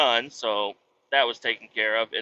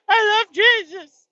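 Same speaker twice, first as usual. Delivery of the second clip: put-on much higher, louder, slow tearful kid voice; whiny little boy; 8 dB louder, slower than usual. Dad imitating his scared son answering the video, tearful. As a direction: wail it like a frightened child.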